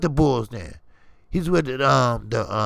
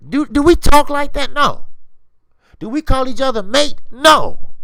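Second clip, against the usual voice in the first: higher, louder, indignant questions; loud rhetorical questions; 7 semitones higher, 5 dB louder than usual. Real, not acted. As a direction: demand it, then answer no. Rhetorical questions to British fans.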